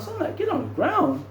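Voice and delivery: can't believe it, squeak; incredulous echo. Retold or imitated.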